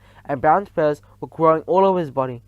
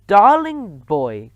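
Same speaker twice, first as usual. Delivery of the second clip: slow sugary coo; 4 semitones higher, 7 dB louder, much slower than usual. A narrator's reading. Witch luring Bruno to the stage, slow sweet coo. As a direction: drip syrup on it. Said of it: "cooed the Grand High Witch".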